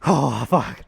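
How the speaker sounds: strained curse mid-lift; lifting weights. Real, not acted. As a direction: force it out under heavy load. Straining at the end of a heavy set.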